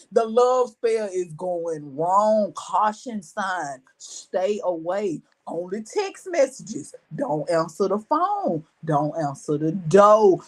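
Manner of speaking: low, drawn-out warning; slow warning announcement. Acted. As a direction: spell out each warning slowly and low. Acted warning, like a failing system.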